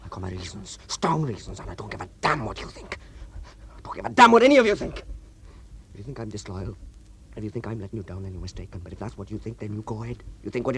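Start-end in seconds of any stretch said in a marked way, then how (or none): 3.8-5.0 raised, fast, angry outburst; angry shout. Acted. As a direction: throw it at them in rage. Furious outburst at the men.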